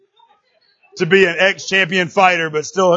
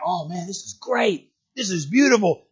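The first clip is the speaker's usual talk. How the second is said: slow, softer mock wonder from the young visitors; 5 dB quieter than usual. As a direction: act out a dazzled young visitor, lightly. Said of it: "They come in like".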